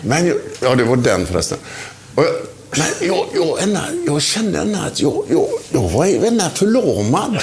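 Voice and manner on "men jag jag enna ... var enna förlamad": high halting stutter, slow at the end; mimicked stammering excuse. Keeper explaining the goal, lightly mocked stammer.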